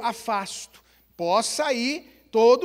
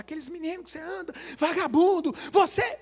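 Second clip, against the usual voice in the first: mock angry father; 5 semitones higher than usual. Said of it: "ele chega em casa e fala".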